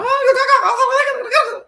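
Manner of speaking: high, stretched giggle through the words; giggly high drawl; tipsy laughing. Real, not acted. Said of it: "I feel like".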